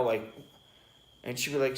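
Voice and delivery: ordinary talk, nothing marked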